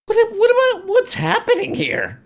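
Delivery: very high falsetto bewilderment; mock bewildered vibe. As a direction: parody a baffled debater, squeaking. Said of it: "That's the vibe from this guy".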